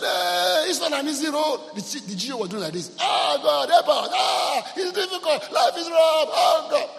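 high, loud mock wailing to God; mock wailing lament. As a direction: ham up a crying pastor's lament. Preacher mimics wailing crying pastors.

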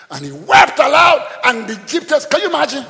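high, loud, impassioned retelling; full-force preaching. Real, not acted. Preacher telling how Joseph wept aloud, impassioned full-force preaching.

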